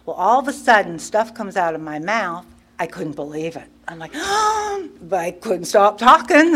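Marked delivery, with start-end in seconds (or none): 4.2-5.0 sharp, high-pitched gasp; shocked gasp. Retold or imitated. Speaker recalling stuff coming out of my mouth, shocked gasp. Mimes shock at own words.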